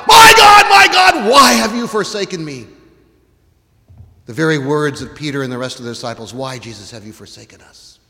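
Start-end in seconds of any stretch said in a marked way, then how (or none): 0.1-2.7 high, loud anguished cry; screaming. Acted. Preacher voicing Jesus crying out to God from the cross. 6.3-8.0 hushed despair. Acted. Disciples' despairing question.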